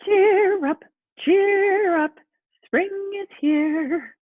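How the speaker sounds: high, loud, bright bunny call; cheery bunny voice. Acted. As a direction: sing out spring like an eager little rabbit. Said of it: "Hoppy said".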